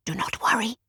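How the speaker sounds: soft reassuring whisper. A narrator's reading. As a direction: whisper it back calmly. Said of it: "he whispered back".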